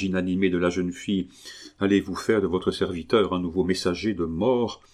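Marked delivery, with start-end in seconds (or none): none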